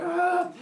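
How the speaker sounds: high loud ah; mock pained shout; pained yell. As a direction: cry out in pain. Father Sky hurt.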